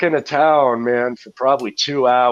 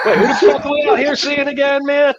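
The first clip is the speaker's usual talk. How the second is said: laughing confused outburst; 13 semitones higher, 4 dB louder than usual. Real, not acted.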